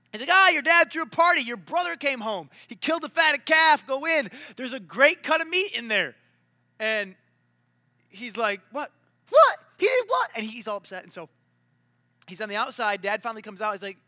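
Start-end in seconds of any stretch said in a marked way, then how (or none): 0.3-6.2 higher, louder eager news; excited servant. Retold or imitated. Servant telling older brother about party, excited. 8.7-10.3 high, loud indignant what; shocked disbelief. Retold or imitated. Older brother hearing of party, shocked disbelief.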